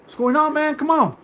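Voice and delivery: high mocking imitation of the caller; a high, needling impression of the caller. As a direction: mimic him in a high, pushy voice. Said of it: "And then you would have said".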